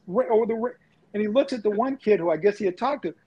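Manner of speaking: ordinary talk, nothing marked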